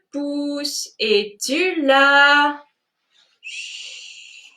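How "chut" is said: soft hushing whisper; whispering over a crib. Acted. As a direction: whisper a sleepy shush. Sleeping thumb hushes again.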